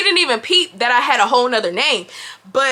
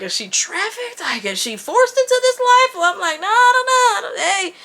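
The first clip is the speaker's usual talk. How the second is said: mock concern; 8 semitones higher than usual. Mock pity over trafficked girl.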